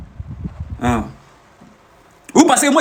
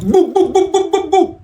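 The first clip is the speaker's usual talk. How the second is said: mock bou noises; 10 semitones higher, slower than usual. Mocking brute force with noise.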